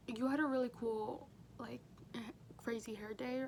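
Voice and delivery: ordinary talk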